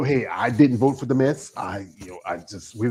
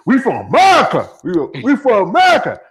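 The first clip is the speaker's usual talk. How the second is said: loud proud boast, much higher; proud shout; 9 semitones higher, 10 dB louder than usual. Retold or imitated. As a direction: shout it with pride. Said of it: "you say".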